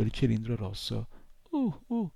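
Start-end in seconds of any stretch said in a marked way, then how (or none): 1.5-2.1 a high, stunned little squeak; baffled uh uh. A narrator's reading. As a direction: squeak it out, completely at a loss. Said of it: "fece l'incubino sconcertato". (uh uh sconcertato, acuto)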